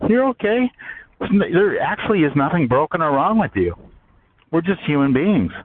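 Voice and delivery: lightly voiced kindly God; God reassuring. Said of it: "God's like, you know, my higher power's like".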